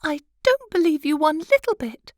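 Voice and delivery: high, light wife's voice; high pitched fussy little wife; butterfly wife. Acted. Wife mocks husband.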